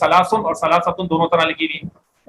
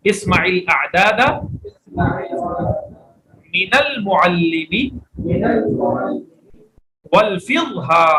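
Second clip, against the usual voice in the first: Arabic read out very slowly, word by word; teacher models the Arabic line for students to repeat, slow and deliberate; much slower than usual. Shown as a demonstration. Teacher reading the Arabic aloud so the class can copy, very slow. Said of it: "پڑھیے ذرا".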